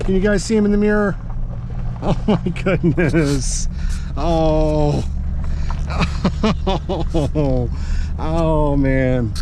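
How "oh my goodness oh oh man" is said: slow, melting laugh over a cute dog; laughing fondly. Real, not acted. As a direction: laugh through the words, charmed.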